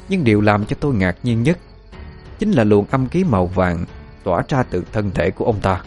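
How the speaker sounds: usual speaking voice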